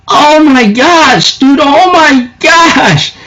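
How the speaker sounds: slow, high, drawn-out oh my gosh; real shock. Real, not acted. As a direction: let the shock sink in slowly. Reacting to a shock ending.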